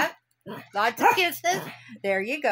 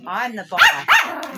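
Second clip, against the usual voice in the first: slow mock-stern boast; 2 semitones lower, 7 dB louder, much slower than usual. Teasing the dog, boss game.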